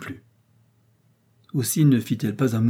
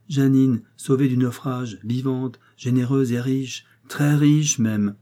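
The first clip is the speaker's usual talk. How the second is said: slow, louder at the end; relishing; 3 semitones higher, 4 dB louder, slower than usual. Acted. Aunt gloating to her daughter, slowly. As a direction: savour every word.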